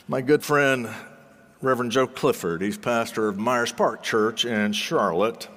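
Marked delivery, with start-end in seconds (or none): none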